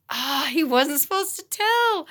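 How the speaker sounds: high, exaggerated gasp of protest; mock dismay. Teacher learning the boy told her secret, mock dismay. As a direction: whine it in playful exasperation.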